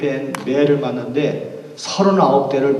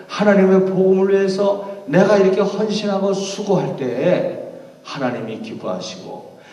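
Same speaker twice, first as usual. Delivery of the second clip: normal talking voice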